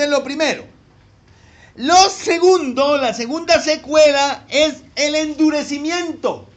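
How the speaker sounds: loud, ringing sermon delivery; full-force preaching. Acted. Pastor preaching the second consequence, loud and ringing. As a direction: preach it out to the back pews.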